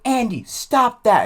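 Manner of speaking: high, loud, slow telling-off; mock teacher scolding. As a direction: order the boy to stop, loudly. Teacher scolding a student mid-fit.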